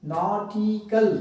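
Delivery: Nautical slowly split into syllables. Shown as a demonstration. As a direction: break nautical into spaced syllables. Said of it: "अलग-अलग syllables को". (Nautical को अलग-अलग syllable में, धीरे)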